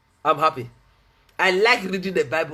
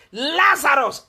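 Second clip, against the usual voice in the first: raised, preacherly call; loud cry; 7 semitones higher, 4 dB louder, slower than usual. Acted. Preacher voicing Jesus calling the dead man, moderately loud cry. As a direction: call out the name. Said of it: "he cried with a loud voice".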